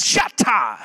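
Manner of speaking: preacher imitating a Pentecostal demon rebuke. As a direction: fire off Shatta like a Pentecostal casting out a demon. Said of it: "That's for some of y'all Pentecostals".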